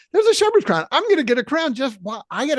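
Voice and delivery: hammed-up greedy joy; young preacher gleeful about his crown; mock eagerness. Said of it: "imagining eager young pastor".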